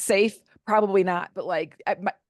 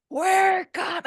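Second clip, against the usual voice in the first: imitating a drunk man's bellow, much higher and louder; 12 semitones higher, 5 dB louder than usual. Drunk husband bellowing in the car, much higher and loud. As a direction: belt it out like a drunk man yelling. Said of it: "he starts bellowing".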